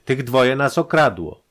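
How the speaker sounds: loud, high, slow accusation; agitated accusation. A narrator's reading. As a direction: accuse loudly, drawing the words out. Robbed man accuses the servants.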